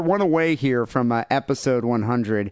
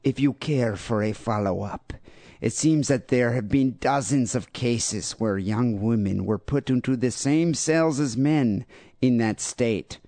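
deliberate, badly faked Brazilian accent; horrible Brazilian accent. Host reading out the rest of a listener's email, fake Brazilian accent. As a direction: put on a clumsy mock Brazilian accent. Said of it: "makes me wanna use this accent".

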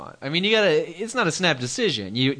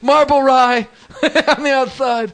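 high, louder, slow and giggling; laughing put-on voice; 11 semitones higher, 7 dB louder than usual. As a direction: draw it out through laughter.

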